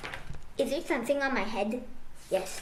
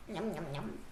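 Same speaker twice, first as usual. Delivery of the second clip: munching noises; 9 semitones lower, 13 dB quieter than usual. Acted. Piggie munching.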